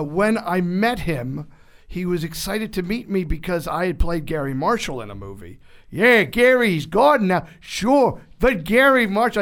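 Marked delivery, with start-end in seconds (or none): 5.9-9.4 loud, high and slowed mock voice; loud impression. Guest imitating Tom Hanks recalling Gary Marshall, loud and slow. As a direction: boom it so it hurts the ear. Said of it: "I'll hurt your ear".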